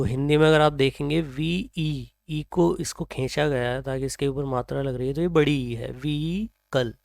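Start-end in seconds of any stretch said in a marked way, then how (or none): 5.9-6.9 व्हीकल drawn out slowly with no h sound, as vikal; silent h. Shown as a demonstration. Teacher slowly modelling व्हीकल without the h. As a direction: stretch व्हीकल slowly and drop the h entirely. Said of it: "इसमें एच पूरी तरह से silent है".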